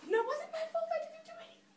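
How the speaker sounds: high, rushed small-voiced denial; squeaky hurried self-defence; protesting blame. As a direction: plead innocence in a quick, high voice. Defends self against imagined blame.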